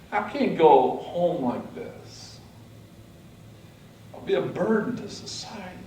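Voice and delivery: frantic retold plea to the doctors; desperate pleading. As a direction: beg them, voice straining, slowing on the last lines.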